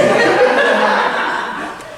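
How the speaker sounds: unvoiced airy laughter; breathy laugh. Beverly laughing at his own joke, airy laugh.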